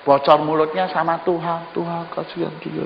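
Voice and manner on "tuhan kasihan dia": hushed mock prayer. Gossiping disguised as prayer.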